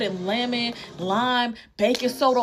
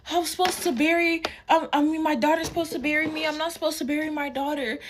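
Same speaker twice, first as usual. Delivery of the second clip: a touch of tearful pleading, higher; imitating crying mom; 7 semitones higher than usual. Mother crying to her teenage daughter, mildly imitated tearful pleading. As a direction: mimic mom sobbing over her daughter. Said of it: "she just crying".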